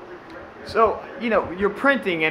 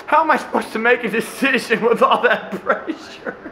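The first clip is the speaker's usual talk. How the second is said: laughing through it; 7 semitones higher, 3 dB louder than usual. Real, not acted. Repeating the kid's line while giggling uncontrollably. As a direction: try to get the line out while cracking up. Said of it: "that uncontrollable giggle".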